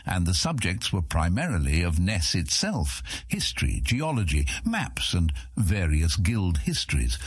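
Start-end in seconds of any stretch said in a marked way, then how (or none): none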